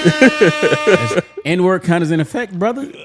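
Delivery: slow, loud, high mock announcer call. Calling out his friend's N-word, slow mock announcer. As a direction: announce it like a referee, drawn out.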